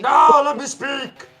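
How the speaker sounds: loud, slowed insistent shout. Real, not acted. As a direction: demand the floor. Interrupted, demanding to talk.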